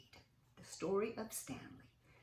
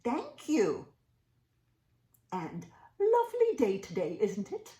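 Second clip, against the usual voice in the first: polite Stanley; 3 semitones higher, 8 dB louder than usual. Acted.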